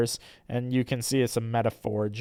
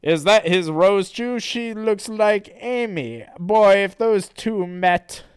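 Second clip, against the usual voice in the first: lightly voiced hero, higher and drawn out; musing cartoon hero, raised pitch; Sonic; 8 semitones higher, 6 dB louder than usual. Sonic wonders about Rose Chew, lightly voiced hero. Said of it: "And then Sonic says".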